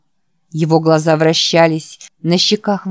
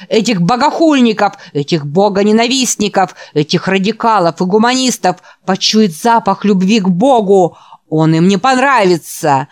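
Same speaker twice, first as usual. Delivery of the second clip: preaching at full force; 4 dB louder than usual. Acted. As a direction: build to a roaring climax. Preacher in a frenzy, shouting. (проповедь в исступлении)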